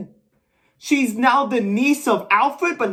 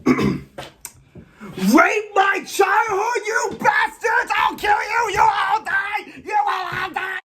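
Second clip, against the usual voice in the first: hysterical rage; 5 semitones higher than usual. Acted. Fan screaming at filmmakers over his childhood, unhinged rage. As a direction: scream it like a man gone berserk.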